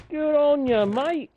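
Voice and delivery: loud, high, badly faked Australian accent; big, cheesy Australian congratulations; attempted Australian accent. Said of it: "say it in another accent".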